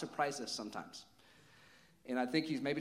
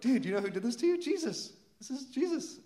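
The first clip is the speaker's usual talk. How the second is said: high, thrilled revelation; excited proclamation; 11 semitones higher, 5 dB louder than usual. Retold or imitated. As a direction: re-enact telling a friend amazing news, pitch soaring. Excitedly telling healed man who did it.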